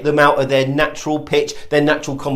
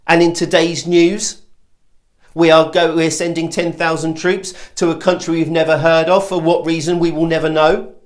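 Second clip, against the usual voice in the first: mock news report lifting at "know"; tentative newsreader, "news" and "know" tilted upward; upward inflection newsreader. Shown as a demonstration. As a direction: read headlines as questions, rising on "news" and "know". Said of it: "go up at their end of their sentences".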